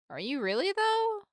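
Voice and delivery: a sharp high lift on "though"; surprised doubt. Acted. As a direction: question it with disbelief. Doubting question, voice jumps up.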